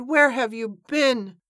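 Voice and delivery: high, drawn-out exclaimed question; crying out. A narrator's reading. Evangeline cries out to long-absent Iris, high and drawn out. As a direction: stretch it out in an eager high cry. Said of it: "she cried".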